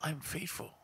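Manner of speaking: ordinary talk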